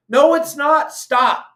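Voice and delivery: loud, high, furious no; angry shout. Real, not acted. Host yelling at listeners who back closures, furious. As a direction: shout them down hard.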